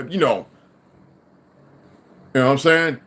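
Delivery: normal talking voice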